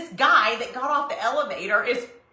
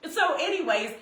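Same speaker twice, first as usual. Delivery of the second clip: usual speaking voice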